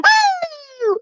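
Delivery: a high mock cheer on Woo. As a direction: mimic a silly high Woo. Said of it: "You going".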